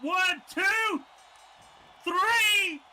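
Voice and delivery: loud, high pinfall count; shouted count. Real, not acted. Commentator counting the pinfall for viewers, shouting. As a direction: count it out over the crowd.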